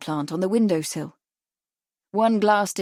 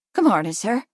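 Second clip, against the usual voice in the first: light Irish brogue greeting a customer; a hint of an Irish shopkeeper's lilt. A narrator's reading. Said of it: "in a voice that was coloured with an Irish brogue".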